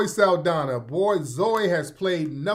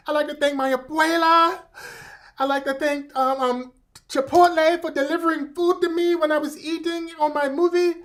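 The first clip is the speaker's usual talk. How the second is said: Zoe Saldana; 7 semitones higher than usual. Retold or imitated. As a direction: gush thanks in an exaggerated Spanish accent. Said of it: "she had the accent and everything".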